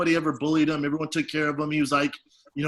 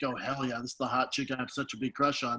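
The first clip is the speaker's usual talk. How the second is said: hushed inner glee; 4 semitones lower, 7 dB quieter than usual. Retold or imitated. Kid's thrilled inner thought, hushed.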